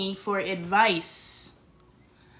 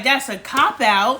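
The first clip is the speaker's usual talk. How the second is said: louder, slow mocking of her words; mocking quote; 2 semitones higher, 7 dB louder, slower than usual. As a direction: throw her words back at her. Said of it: "you're like".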